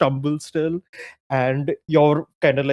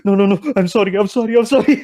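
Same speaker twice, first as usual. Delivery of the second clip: panicked apologies, pitched higher; mock panic; 8 semitones higher, 3 dB louder, faster than usual. The falling player apologizes in a panicked plea. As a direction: plead in a rising panic. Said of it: "you're like".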